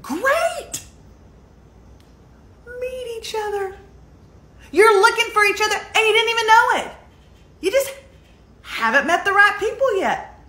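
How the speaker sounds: high-pitched, excited matchmaking cry; exuberant. Acted. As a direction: burst out high, as if introducing two strangers. Exclaims as if introducing the two.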